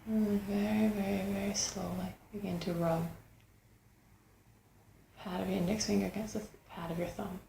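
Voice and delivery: unhurried meditation guide, long pauses between phrases; guided meditation. Acted. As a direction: guide them gently inward, leaving space after each phrase.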